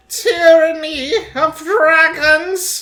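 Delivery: big slow show-intro announcement; announcer voice. Acted. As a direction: boom it out like a show opener.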